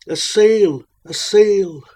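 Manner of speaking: loud, high cry of hope. Acted. Parched mariner hailing a distant ship, loud cry. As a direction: shout it out across the water. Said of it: "and cried".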